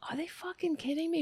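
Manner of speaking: fast, voiceless whisper of outrage; whispered disbelief. Real, not acted. Reading an absurd comment, a fast outraged whisper. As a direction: spit it out under your breath, quick.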